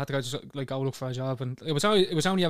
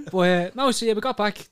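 usual speaking voice

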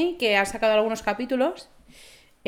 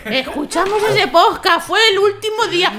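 agudo, muy fuerte y arrastrado, al borde de la risa; recuérdalo a gritos como un drama del apocalipsis; superviviente melodramático; 7 semitones higher, 8 dB louder than usual. Acted. Acted doomsday survivor recalling, exaggerated. (superviviente exagerado, lamento agudo y teatral)

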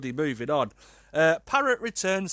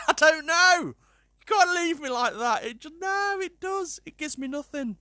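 worked-up, pitched-up protest; exasperated wail; 13 semitones higher, 4 dB louder than usual. Real, not acted. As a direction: whine in helpless frustration. Frustrated by unfinished story.

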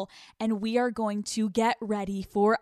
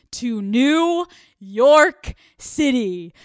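slow, drawn-out and much louder; excited announcement; 3 semitones higher, 10 dB louder, much slower than usual. Real, not acted. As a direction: stretch out the city name like a grand reveal. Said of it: "I'm so excited".

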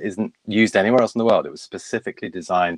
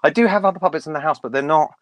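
high puppet voice, loud at the start; puppet self; 8 semitones higher, 4 dB louder than usual. Pretend. Puppet answering curious Michael, high excitable puppet voice. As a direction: announce yourself as an excitable high-pitched puppet.

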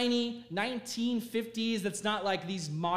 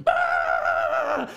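loud hammed-up bawl; mock baby crying; 5 semitones higher, 9 dB louder than usual. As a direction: wail like a little baby, exaggerated. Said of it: "starts crying like a little baby".

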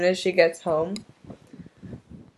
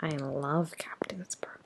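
whispering; 4 semitones lower, 11 dB quieter than usual. Real, not acted. Whispered confession of love.

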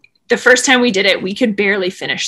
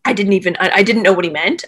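ordinary delivery

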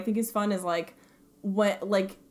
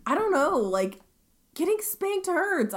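usual speaking voice

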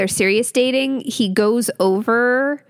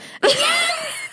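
shrieking laugh. Real, not acted. Cohost cracking up at Ava following him, shrieking laugh. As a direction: let out a squealing laugh.

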